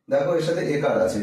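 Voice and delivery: ordinary talk, nothing marked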